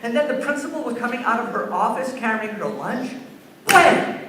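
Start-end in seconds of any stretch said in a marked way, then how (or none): 3.7-4.2 high, loud burst; explosive storyteller exclamation; sudden impact cry. Retold or imitated. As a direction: shout it like a sudden crash. Acting out a sudden collision.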